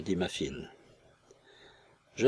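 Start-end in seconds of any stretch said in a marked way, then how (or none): none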